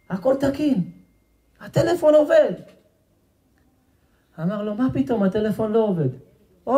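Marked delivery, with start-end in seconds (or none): 0.1-2.5 very high-pitched repairman's proclamation; insistent announcement. Acted. Seller acted announcing phone works. 4.7-6.1 high-pitched disbelieving retort; incredulous protest. Acted. Old man disputes the seller.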